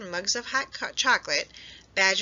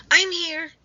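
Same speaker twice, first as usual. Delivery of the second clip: much higher, loud reply; muffled answer; 10 semitones higher, 8 dB louder than usual. A narrator's reading. Rabbit under the snowdrift, calling back. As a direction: call up from under snow. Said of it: "answered Rabbit".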